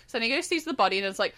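ordinary delivery

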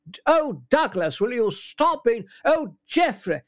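high, exasperated Thatcher scolding; Thatcher puppet voice. Shown as a demonstration. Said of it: "added that little bit extra in".

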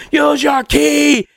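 quoting roommate's yell through the door; mock shout. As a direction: mimic him yelling the same line. Repeating Rowdy's yell.

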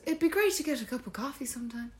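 high, soft, quick chirp; friendly stranger. Retold or imitated. Stranger invites crying woman for coffee, high and chirpy.

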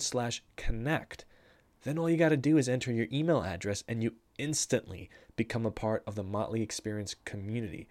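hushed conspiratorial ad read; sneak the plug in quietly. Acted. Sneaky whispered plug for newsletter.

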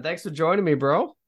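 usual speaking voice

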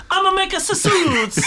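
very high, loud mock Italian accent; over-the-top Italian parody, shouted up high; Jared Leto. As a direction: squeal it as a cartoon Italian. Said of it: "he comes out and he's like".

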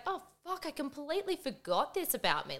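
high, soft, startled realisation; sudden realisation. Retold or imitated. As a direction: recall your own hushed, high-pitched surprise. Acting her surprised first reaction to comments.

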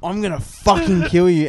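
loud, high mock threat; imitated furious yell. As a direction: imitate an enraged player threatening the ref at full voice. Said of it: "he's on the sideline still yelling out".

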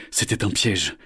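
plain reading voice

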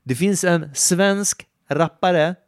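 hammed-up eagerness; excited mock reply. Instructor voicing eager reply.

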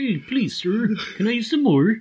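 a hammed-up little beggar boy; begging orphan. Mocking the workmate's quote, high mock plea. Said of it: "How are you gonna make that English?".

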